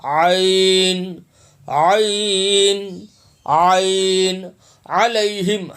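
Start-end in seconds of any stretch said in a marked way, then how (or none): 0.0-4.5 عین said slowly, ع pressed from the throat; slow guttural عین. Shown as a demonstration. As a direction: squeeze عین out from the throat, drawn out. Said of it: "حلق سے".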